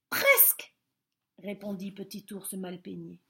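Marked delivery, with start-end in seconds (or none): 0.1-0.7 very high, loud call. A narrator's reading. Bear calling down to the rabbits, very high and loud. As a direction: shout back down.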